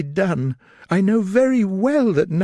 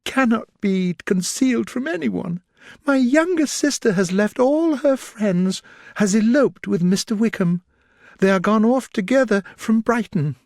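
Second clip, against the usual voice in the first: much higher, tearful distress; distressed after tears; 9 semitones higher than usual. A narrator's reading. Elizabeth tearfully telling Darcy of Lydia's elopement, distressed. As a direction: pour out the bad news, near tears. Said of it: "At length she spoke again".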